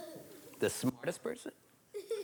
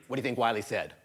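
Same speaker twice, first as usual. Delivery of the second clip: usual speaking voice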